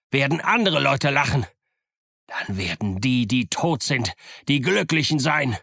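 furious pirate spitting threats; vengeful pirate Silver. Acted. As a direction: snarl the threat on the way out.

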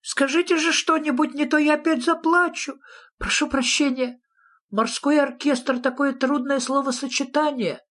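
very high, fretful little voice; high-pitched small creature apologising; anxious pleading. A narrator's reading. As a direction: beg as if about to burst into tears. Nephew pleads, about to cry again.

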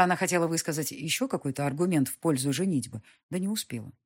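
plain reading voice

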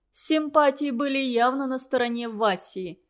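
plain reading voice

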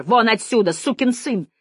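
angry shout. A narrator's reading. Janine orders the lieutenant out, shouting angrily. Janine screams at Decker to leave.

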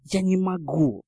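usual speaking voice

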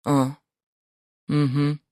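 ordinary delivery

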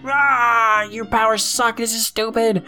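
put-on high-pitched kid tantrum; mock rage. Kid lashes out at Beast Man's powers, mock rage. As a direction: imitate a kid raging, pitch shooting up. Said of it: "like, rage".

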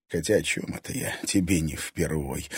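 low, soft, resigned remark; bitter aside. A narrator's reading. Egor recalling daughter's past to her, bitter aside. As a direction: drop the voice in weary contempt.

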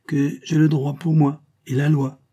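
insistent claim. Acted. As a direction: press the point in a quarrel. Quarrel over custody of the child.

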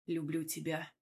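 low, hushed, husky confession; hoarse husband. A narrator's reading. Husband confessing love to his wife, low and hoarse. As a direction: murmur it low, like a husky-voiced man. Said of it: "хрипло проговорил муж".

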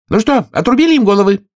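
high, shouted royal demand; shouted question. A narrator's reading. Queen shouts to her soldiers for a report, high. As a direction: call it out sharply and high. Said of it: "крикнула королева".

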